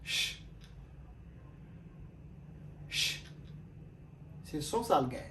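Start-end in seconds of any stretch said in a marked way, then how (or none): 0.0-0.4 "ch" said as a soft hushing sh; sh sound. Shown as a demonstration. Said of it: "S H". 2.9-3.2 "ch" said as a soft hushing sh; sh sound. Shown as a demonstration. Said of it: "S H".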